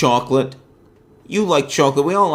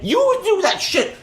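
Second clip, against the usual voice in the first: mock high voice. Mocking him as a younger man.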